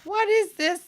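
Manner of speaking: high, loud sudden shock. Real, not acted. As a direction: blurt it out in disbelief. Shocked at the video.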